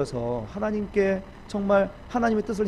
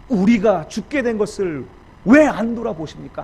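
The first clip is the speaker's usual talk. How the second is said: protesting; 4 semitones higher, 9 dB louder than usual. Acted. Disciples waking Jesus in the storm, protesting loudly. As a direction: cry out in mock reproach. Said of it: "항변하는 거예요".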